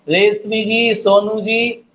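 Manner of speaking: usual speaking voice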